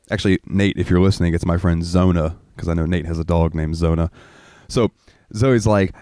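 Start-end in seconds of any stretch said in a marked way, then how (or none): none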